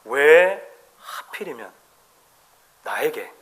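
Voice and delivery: slow, sinking mock self-pity; self-pitying why me. Preacher voicing the ungrateful heart, a hint of self-pity. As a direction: drag the words, then let them fall away. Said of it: "라고 우리가 말하기 시작하죠".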